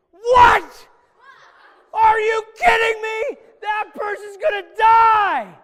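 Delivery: very high, very loud outraged shouting; furious yell. Acted. Teacher acts out King David hearing of the stolen lamb, furious yell. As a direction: explode in disbelief and rage. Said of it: "he was yelling".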